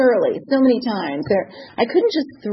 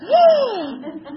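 gasp; 11 semitones higher than usual. Retold or imitated. Shock at replaced diamond.

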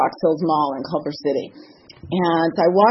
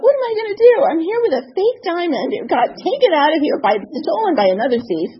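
panicked thought; 9 semitones higher than usual. Retold or imitated.

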